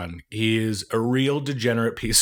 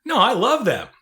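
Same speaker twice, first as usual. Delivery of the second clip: eager; 12 semitones higher, 5 dB louder than usual. Retold or imitated. Imitating guy's eager reply.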